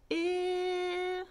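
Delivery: high, steady "Eh" drawn out; held vowel. Shown as a demonstration. Teacher showing it can keep going, high held "Eh". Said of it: "hear how you can keep it going".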